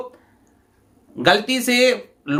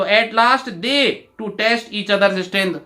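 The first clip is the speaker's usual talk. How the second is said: slow example reading; much slower than usual. Shown as a demonstration. Teacher rereads question.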